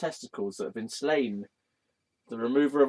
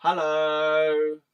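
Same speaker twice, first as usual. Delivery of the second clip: playful calling hello; 4 semitones higher, 4 dB louder than usual. Pretend. Calling hello to his Ganesh figure.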